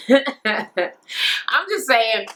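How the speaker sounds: slow words through laughter; laughing. Real, not acted. Recapping the twerk question, real laughter. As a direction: laugh it out as you talk.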